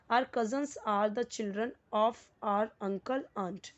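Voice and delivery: each word spaced out, very slow; slow dictation. Shown as a demonstration. Teacher reading a sentence aloud to the class, slow and deliberate. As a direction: read it word by word for students. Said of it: "teacher reads example sentence".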